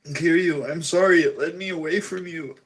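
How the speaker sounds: high-pitched, weepy confession; tearful sister. Acted. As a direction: plead with a brother through acted tears. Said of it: "she starts weeping".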